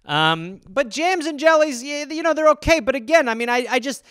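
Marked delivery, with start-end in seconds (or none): none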